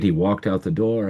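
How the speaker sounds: ordinary delivery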